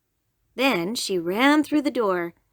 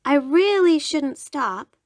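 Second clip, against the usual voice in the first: put-on little girl voice; 6 semitones higher, 5 dB louder, slower than usual.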